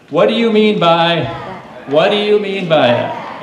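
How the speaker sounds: loud, slow call; loud call to the kids; yell it out. Shown as a demonstration. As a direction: shout it with the crowd. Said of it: "you can yell this one out".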